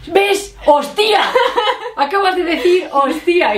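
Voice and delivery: high, insistent mock protest, half laughing; imitating indignant Gordon. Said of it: "está súper seguro y se enfada". (imitación aguda e indignada, entre risas)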